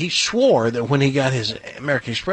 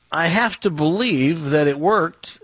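normal talking voice

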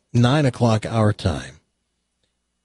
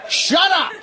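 mock shout. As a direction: yell it to silence the couple. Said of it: "Yeah, like".

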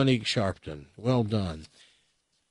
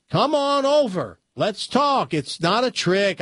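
high, drawn-out coaxing dog voice; talking dog; 9 semitones higher, 3 dB louder, slower than usual. Acted. Dog convincing a doubting buyer to come over, high and coaxing. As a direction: coax slowly in a high-pitched dog voice. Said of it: "But the dog says".

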